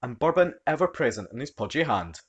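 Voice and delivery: ordinary delivery, nothing marked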